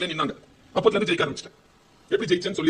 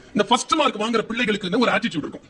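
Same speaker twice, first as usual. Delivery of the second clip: ordinary delivery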